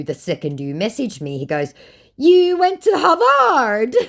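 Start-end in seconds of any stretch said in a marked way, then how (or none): 2.2-4.0 very high, loud, stretched-out mimicry; hammed-up high imitation; mock scammer. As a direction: ridicule the texter in a high, slow blare. Mocking scammer's message.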